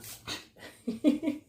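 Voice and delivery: breathy burst of giggling, rising high; real laughter. Real, not acted. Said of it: "See, we're laughing already".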